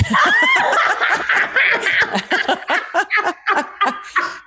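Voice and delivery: high, real laughing shriek; big high laugh. Real, not acted. Joke about nude photo.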